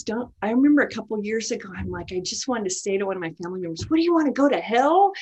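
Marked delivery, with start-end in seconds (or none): 3.9-5.1 quick, louder, high-pitched challenge; exasperated blurt. Retold or imitated. Preacher recalls confronting a family member, exasperated blurt. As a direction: blurt it out in alarm, fast and forceful.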